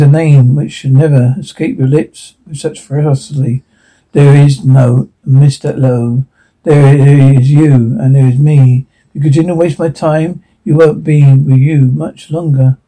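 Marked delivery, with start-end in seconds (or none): 4.1-8.8 slow, deliberate, cold menace. Acted. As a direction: spell it out slowly, word by word. Gangster lays down slow threat.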